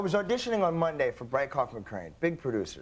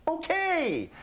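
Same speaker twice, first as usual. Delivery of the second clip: high, drawn-out okay from the bystander; a high sing-song okay; high stranger voice; 14 semitones higher than usual. Retold or imitated. Bystander agrees to find the family, high voice. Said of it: "And he goes".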